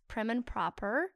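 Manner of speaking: slow, careful British accent; prim and proper British delivery. Shown as a demonstration. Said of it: "I like doing that".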